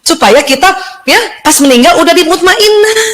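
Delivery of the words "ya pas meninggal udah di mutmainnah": ordinary talk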